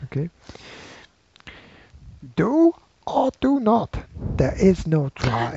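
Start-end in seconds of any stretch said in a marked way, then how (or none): 2.4-5.6 slow character voice, opening high and dropping; Yoda. Acted. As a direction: intone it slowly like a little sage. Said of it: "That's Yoda, because [name] always does it".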